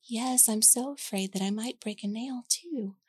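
mock fear in a sneering singsong; teen mocking her dad's worry, sarcastic and condescending. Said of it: "dripping with condescending tone".

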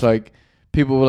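usual speaking voice

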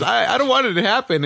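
high, teasing imitation of sadness; mock cracking voice. Friend teasing another over his sadness, mock cracking voice. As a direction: mimic a friend's sad, breaking voice. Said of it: "as it's cracking".

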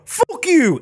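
high, loud "Fuck you"; imitating a fighter snapping at a refusal; mock angry retort. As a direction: snap it back at him. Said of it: "and you go".

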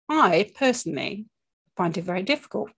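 ordinary delivery

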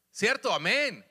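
usual speaking voice

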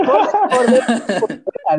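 said through real laughter; laughing. Real, not acted. Joking to friends about crying inside and out, laughing. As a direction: giggle through the words.

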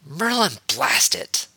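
high start, dropping on the curse; shouted curse. A narrator's reading. Harry from inside the hangar, shouted curse. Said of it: "Harry's shouted voice emerged".

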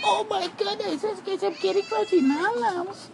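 put-on very high squeaky baby voice; squeaky baby talk. Offering the cats crunchy treats, squeaky baby talk. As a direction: gush at the cats like they're babies.

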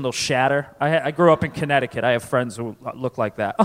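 ordinary talk, nothing marked

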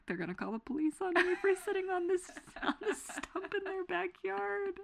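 squeaky panicked whisper; panicked high voice. Retold or imitated.

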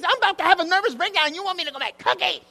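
high, fast indignant rant; mock outrage. Woman storming out of pastor's office, high indignant rant. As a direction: rattle it off in acted disbelief.

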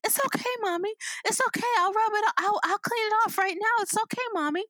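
put-on high, reassuring child voice; high-pitched imitation of a forgiving child; sweetest little girl. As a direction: comfort mommy like a sweet small girl. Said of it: "had the sweetest girl".

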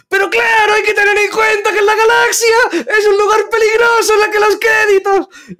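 high shouted alien-trader accent, slowing at the end; Watto voice. Shown as a demonstration. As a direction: bellow it way up high like a greedy junk dealer. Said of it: "practicando la voz".

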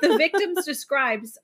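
words broken by giggles; laughing while reading. Real, not acted. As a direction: read it through a giggle.

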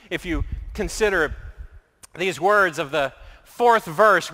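usual speaking voice

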